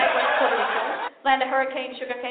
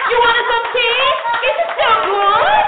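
put-on loud, higher, slow fussing old woman; Italian grandma; 10 semitones higher, 9 dB louder, slower than usual. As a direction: push the old tea on them. Grandma offering reused tea bag.

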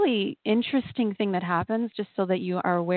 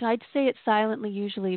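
normal talking voice

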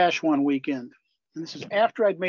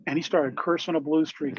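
ordinary talk

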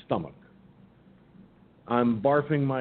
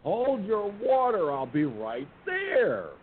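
very high call that slows right down; exasperated shout at the door, drawn out; impatient holler; 9 semitones higher, much slower than usual. Retold or imitated. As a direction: make them wait, irritated. Impatient reply to the knocking.

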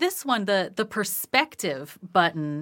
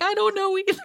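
much higher, laughing the words out; laughing helplessly; 12 semitones higher, 11 dB louder, faster than usual. Retold or imitated. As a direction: give up with a laugh. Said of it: "I'm like".

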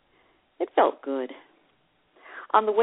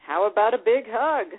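playful, teasing invitation, pitched up; 6 semitones higher, 4 dB louder than usual. Retold or imitated. Teasing her date at the door, playful. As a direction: coax him lightly, with a wink in the voice. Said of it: "and teased".

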